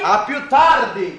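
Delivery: slow, loud goodbye call. Acted. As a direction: call it out as you leave. Husband leaving, calling out.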